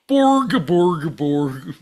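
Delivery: loud, high Swedish gibberish, easing down; mock Swedish. Clowning as Swedish Santa, loud mock-Swedish chant. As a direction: bark out hammed-up Swedish nonsense syllables. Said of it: "It's Swedish".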